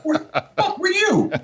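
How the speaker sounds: very high, mock-outraged squeal; incredulous outburst. Owner retelling finding the hidden cat, incredulous outburst. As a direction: demand it in high, comic disbelief.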